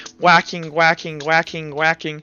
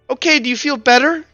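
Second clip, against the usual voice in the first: higher-pitched character voice; Felix; 8 semitones higher than usual. Acted. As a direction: check on the patient in a high voice. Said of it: "and he goes".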